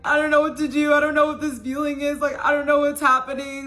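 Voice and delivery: high, loud, sob-choked mock crying; retelling her tearful first-love breakdown, high and loud; retold bawling. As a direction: relive the tearful overwhelmed outburst. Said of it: "bawling my eyes out and being like".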